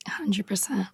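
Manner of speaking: soft unvoiced whisper; whispered "A hundred percent" in support; whispered agreement. Real, not acted. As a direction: breathe the agreement under the talk. Agreeing softly.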